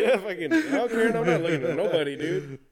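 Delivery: loud laughing. Pretend. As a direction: half-shout it through laughter. Playing husband at con.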